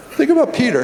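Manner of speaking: emphatic repeat. Shown as a demonstration. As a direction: lift the whole phrase high to drive it home. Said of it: "repeated for emphasis".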